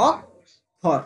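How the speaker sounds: অক্ষর broken as "ok ... khor" with a pause; two separate beats in অক্ষর, gap after "ok"; syllable split. Shown as a demonstration. Teacher counting two beats for pupils, split apart. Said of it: "দেখ দুটো হচ্ছে".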